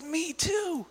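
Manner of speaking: delighted squeal. Retold or imitated. Man delighted again.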